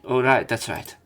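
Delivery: ordinary talk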